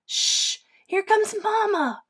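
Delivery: urgent hushing, then high bird voice; Pip hissing. A narrator's reading. Baby bird warns the others Mama's coming, hissing urgently. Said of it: "hissed Pip".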